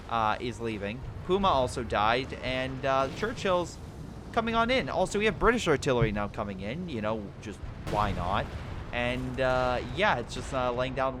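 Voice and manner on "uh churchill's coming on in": ordinary talk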